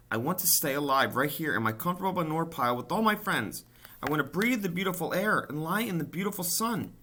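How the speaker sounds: high, loud weepy wail; tearful pleading. A narrator's reading. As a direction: wail about everything you'd lose. Wilbur weeping about living.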